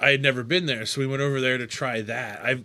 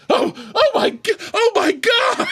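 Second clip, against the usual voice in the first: high, loud startled oh my God; retelling the shock of the owner suddenly sitting there, high excited shout; 8 dB louder than usual. Retold or imitated. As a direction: yelp it in disbelief. Retold shock at owner appearing.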